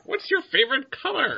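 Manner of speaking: high, squawky bird voice; questioning raven. Pretend. Raven plays the quiz master, high creature voice. Raven as Python bridge keeper.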